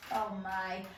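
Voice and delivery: ordinary talk, nothing marked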